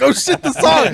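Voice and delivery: high, loud laughing exclamation; panicked laugh at the forgotten song. Retold or imitated. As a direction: crack up as the song hits. Laughing quote of onstage panic.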